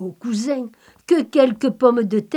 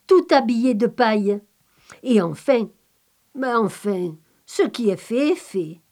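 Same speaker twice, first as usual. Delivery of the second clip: ordinary delivery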